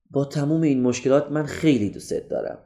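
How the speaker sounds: soft, tender declaration of love; عاطفی و آروم. Shown as a demonstration. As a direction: say it quietly, with feeling. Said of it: "با تون صدای پایین بگید". (با صدای پایین و عاشقانه)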